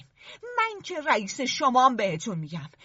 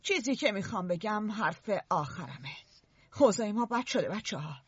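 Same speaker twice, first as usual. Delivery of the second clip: lower, softer serious voice; grave and low; 5 semitones lower, 7 dB quieter than usual. Acted. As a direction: bring it down, heavy. Ralph's grave last word.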